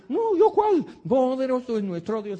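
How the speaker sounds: faked cheer. Preacher mocking a believer who fakes joy, high and forced. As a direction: put on forced holy cheer. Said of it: "Y dices".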